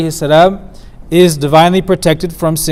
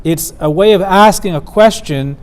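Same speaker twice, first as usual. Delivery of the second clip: ordinary delivery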